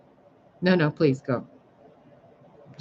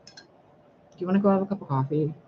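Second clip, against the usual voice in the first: ordinary talk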